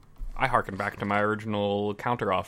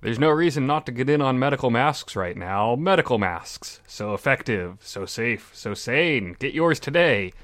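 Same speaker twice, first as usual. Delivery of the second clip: louder, upbeat ad pitch; chipper 1950s ad; 4 dB louder than usual. Pretend. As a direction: sell it like an old TV ad. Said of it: "we now bring to you and say".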